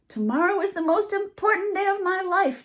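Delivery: high, loud birdy chirp; chirping baby bird. A narrator's reading. Baby bird telling the deer about tomorrow, high chirp. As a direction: chirp it like an excited chick. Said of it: "chirped the baby bird".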